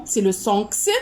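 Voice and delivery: crisp "ks" sound for the letter X. Shown as a demonstration. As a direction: say X as an unvoiced "ks". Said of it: "selon la prononciation".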